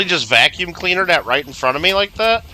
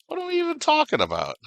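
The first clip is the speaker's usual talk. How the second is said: mock high voice; 6 dB quieter, faster than usual. Repeats the line a third time.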